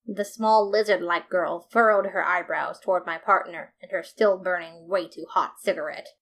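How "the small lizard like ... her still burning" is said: plain reading voice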